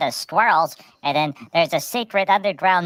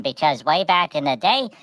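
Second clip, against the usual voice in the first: ordinary talk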